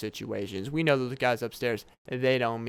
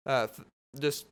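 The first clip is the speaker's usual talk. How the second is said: usual speaking voice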